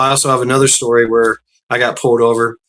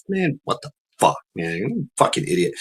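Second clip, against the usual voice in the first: attitude; 3 semitones higher, 8 dB quieter than usual. Retold or imitated. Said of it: "my attitude was like".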